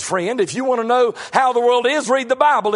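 normal talking voice

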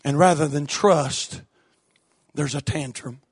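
quiet, weighty pulpit aside; hushed. Real, not acted. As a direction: drop to a low, soft aside. Preacher drops voice for point.

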